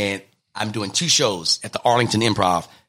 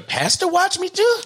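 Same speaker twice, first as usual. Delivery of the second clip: much higher, disbelieving squeak; surprised. Retold or imitated. Comedian stunned that the pastor watches him, high squeak. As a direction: sound stunned. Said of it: "I was like".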